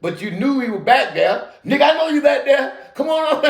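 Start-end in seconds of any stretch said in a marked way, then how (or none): 1.7-3.4 calling out. Real, not acted. Calls out to friend in back.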